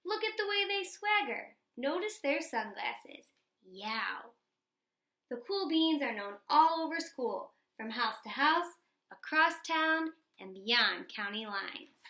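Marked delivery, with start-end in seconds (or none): none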